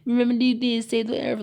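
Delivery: put-on high, bright-eyed kids' TV preachiness; mock the cheesy Disney Channel message; hammed-up Disney kid lesson voice; Disney Channel PSA voice. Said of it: "if I sound like, you know, one of those, like, Disney Channel".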